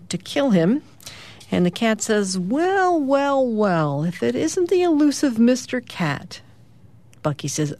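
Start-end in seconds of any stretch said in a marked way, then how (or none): 2.4-6.4 high, drawn-out mock-villain greeting; menacing cat. A narrator's reading. Cat waiting at home to kill Bucky greets him, mock menacing. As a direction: toy with your prey on long, swooping wells.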